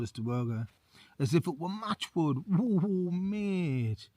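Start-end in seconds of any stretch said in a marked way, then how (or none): 2.5-4.1 a light impressed whoa, pitched up; genuinely taken aback by the feat; amazed reaction to the story. Real, not acted.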